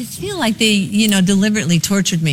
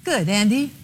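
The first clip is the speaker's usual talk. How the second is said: normal talking voice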